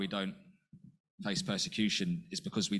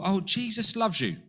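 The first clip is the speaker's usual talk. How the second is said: a sugary imitation, pitched higher; mock sweet evangelist; 9 semitones higher than usual. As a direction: lightly mimic a gushing believer. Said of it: "we might say to people".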